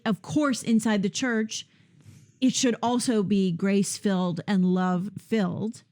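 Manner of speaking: ordinary talk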